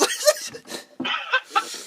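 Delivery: high, breathless giggling; helpless high wheeze of laughter; real wheezing laugh. Real, not acted. Still laughing after spit take.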